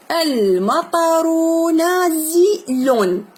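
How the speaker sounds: very slow, drawn-out reading aloud; a teacher's slow, careful model read. Shown as a demonstration. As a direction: stretch each word slowly for the pupil. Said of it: "teacher models slow reading".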